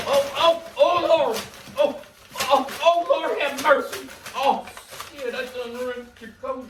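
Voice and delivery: high, loud hammed-up alarm; hammed-up fright over a torn coat; mock panic. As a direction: wail it like the coat's ruined.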